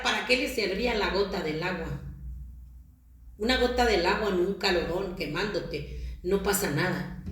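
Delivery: usual speaking voice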